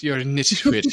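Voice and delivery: light posh English imitation. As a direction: say nitwit in a posh accent. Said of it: "It was so posh".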